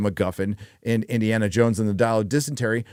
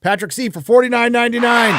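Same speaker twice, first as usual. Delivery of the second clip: much higher and louder donation shout; excited shout; 11 semitones higher, 6 dB louder than usual. Real, not acted. Calling out a donor's name, loud and high. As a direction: announce it big like a hype man.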